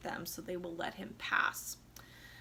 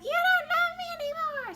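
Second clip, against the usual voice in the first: very high, louder whiny cry; mock wail; 7 dB louder than usual. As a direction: shriek the accusation like a melodrama wife. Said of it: "So she said".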